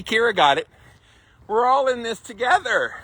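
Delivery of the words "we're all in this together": mock cliché. Repeating the cliché, higher.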